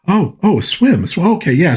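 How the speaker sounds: high, flustered "oh, oh" as it dawns on her; mock embarrassed catch-on; imitating a nervous bride catching on; flustered realization. Flustered wife realizing the swim.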